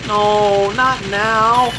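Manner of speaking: ordinary talk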